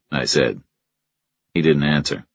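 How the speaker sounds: ordinary delivery, nothing marked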